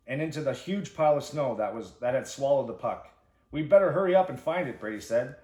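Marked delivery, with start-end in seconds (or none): 3.5-4.8 louder, higher, hurried urgency; urgent call. A narrator's reading. Brady urges his friends to hurry before dark, urgent. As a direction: push them to hurry.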